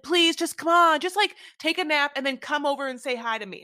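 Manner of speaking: imitated begging, high and loud; mock a pushy, pleading mother. Retold mother begging her daughter to come over, high loud pleading.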